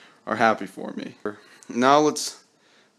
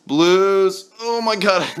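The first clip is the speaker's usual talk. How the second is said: high, loud "blues" and then "oh my god"; real letdown at yet another blue; exasperated groan; 8 semitones higher, 4 dB louder, much slower than usual. Real, not acted. Missed pull, exasperated.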